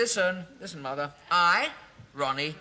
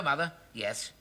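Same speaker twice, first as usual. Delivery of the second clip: ordinary talk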